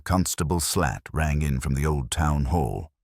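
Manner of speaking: ordinary delivery, nothing marked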